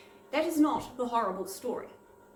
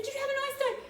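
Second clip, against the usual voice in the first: put-on high, small polite little-girl voice; timid child; 13 semitones higher, faster than usual. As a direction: ask it like a nervous young girl. Nervous child on the phone.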